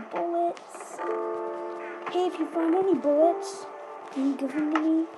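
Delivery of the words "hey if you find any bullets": ordinary delivery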